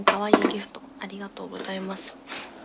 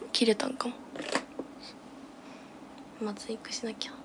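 whispering; 5 dB quieter than usual. Real, not acted. Muttering to herself mid-makeup.

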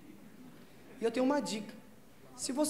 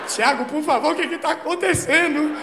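higher, louder desperate begging; mock desperate plea; 6 semitones higher, 9 dB louder than usual. Girlfriend begging Thiago to explain, loud desperate plea. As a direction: plead in a desperate panic. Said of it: "ela começou a ficar desesperada".

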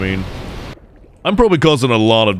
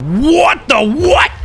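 high, slow cry of disbelief; baffled outcry; 4 dB louder, slower than usual. Real, not acted. Player startled by the game, baffled outcry. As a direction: stretch it out, high and stunned.

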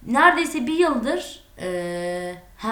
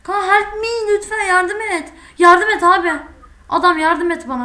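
pleading; 6 semitones higher, 5 dB louder than usual. Real, not acted. Begging friend to save him.

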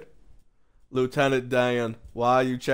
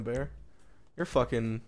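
normal talking voice

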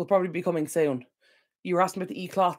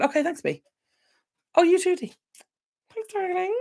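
lilting high thank-you to the chat; high fond thanks to chat viewers; sweet high thanks; 13 semitones higher than usual. Real, not acted.

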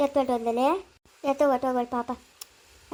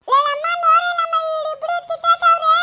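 complaining call; 10 semitones higher, 4 dB louder than usual. Real, not acted. Child complaining about siblings fighting.

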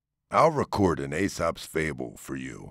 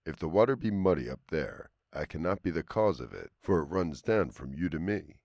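story narration; 5 dB quieter than usual. A narrator's reading. Reads a fable aloud.

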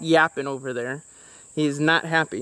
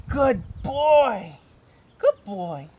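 dog praise; 4 semitones higher, much slower than usual. Real, not acted. Praising puppy.

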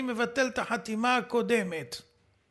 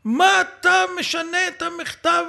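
a louder, higher mock yell; retold yell; 5 semitones higher, 7 dB louder than usual. Host quoting Deri yelling at the rabbi, mock yell. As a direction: throw the question out as a reported shout. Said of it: "צעק עליי צעקות".

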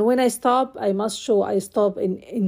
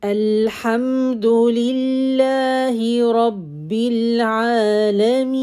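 Quran recitation. Acted. Teacher reciting the second ayah in full.

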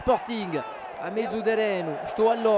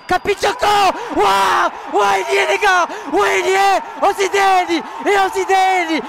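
high, loud, roaring goal call; 3 semitones higher, 3 dB louder than usual. Real, not acted. Radio commentator to listeners celebrating the late goal, ecstatic shouting. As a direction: shout the last-minute winner to the listeners.

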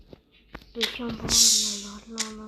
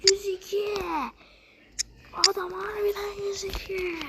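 higher, stunned half-whisper; awed at the number; real awed excitement; excited disbelief; 8 semitones higher than usual. Real, not acted. Reacting to rating.

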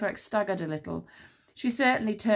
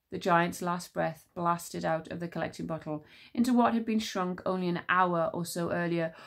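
ordinary delivery

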